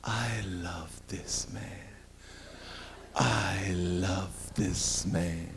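very soft, very slow and low; soft swoon. Retold or imitated. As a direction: melt as you say it. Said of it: "She says".

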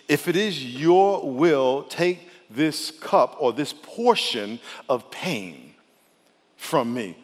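very slow, weighted prayer reading; measured, drawn-out petition; solemn prayer. Acted. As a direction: draw the plea out slowly, sinking at the end. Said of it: "here's what he prayed".

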